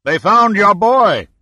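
slow triumphant yell across the water; shouting the news. A narrator's reading. Boat owner shouting the rescue news, slow loud yell.

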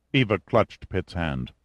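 usual speaking voice

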